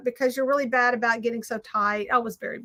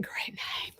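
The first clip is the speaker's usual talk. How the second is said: unvoiced aside of great name; whisper; 12 dB quieter than usual. Real, not acted. To herself over her crafting, a whispered aside. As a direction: breathe it to herself.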